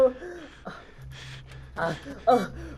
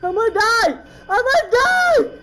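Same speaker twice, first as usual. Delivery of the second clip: loud panic climbing higher; freaking out; 5 semitones higher, 6 dB louder than usual. Real, not acted. Player afraid of dying in game, freaking out. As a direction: cry out, stretching the last words. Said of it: "I'm freaking out here".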